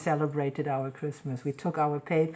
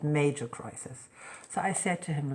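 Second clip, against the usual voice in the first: normal talking voice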